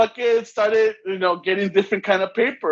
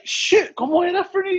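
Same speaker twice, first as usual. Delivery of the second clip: panicked question; 9 semitones higher than usual. Retold or imitated. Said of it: "I'm like".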